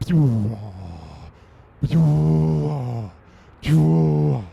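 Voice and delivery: loud mouth-made laser pews; laser zaps. Acted. Comic as Superman shooting heat vision, pew. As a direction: blast each zap out hard.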